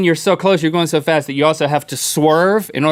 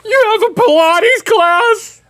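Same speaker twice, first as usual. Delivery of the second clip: high, slow, shouted disbelief; overdone shocked wail, then a gasp; shocked cry; 4 dB louder, slower than usual. Acted. Mock shock at Pilates news.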